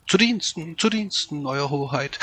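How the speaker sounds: high, eager servant voice; oily courtier greeting his lord; bow and scrape in a high voice; fawning fox. A narrator's reading. Fox fawns before the bear.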